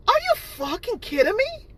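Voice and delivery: high, outraged cry; furious disbelief. Real, not acted. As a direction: spit out the disbelief. Ranting at haters' lies.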